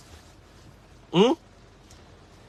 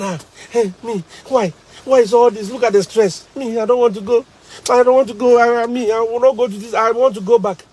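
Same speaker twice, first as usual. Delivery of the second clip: put-on crying baby; 6 semitones higher than usual. Homesick teacher begging to go back home, high wailing plea. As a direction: beg to go home like a man near tears. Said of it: "Then he said".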